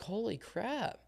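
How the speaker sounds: high, hushed "Holy crap"; amazed. Retold or imitated. Hearing strangers know his grandparents, high hushed awe. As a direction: marvel at the coincidence. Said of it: "we're like".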